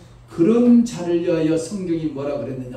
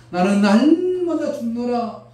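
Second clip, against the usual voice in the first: higher, louder, slow, stress 날마다; Paul proclaiming; 7 semitones higher, 6 dB louder, slower than usual. Retold or imitated. Paul proclaiming he dies daily, loud on 날마다. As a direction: proclaim 날마다 with rising force. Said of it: "바울이 말합니다".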